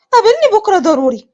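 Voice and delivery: high, pressing demand on the phone; urgent insistence. Acted. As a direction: push for a meeting, urgently. تلح على حبيبها ليقابلها. (بإلحاح شديد)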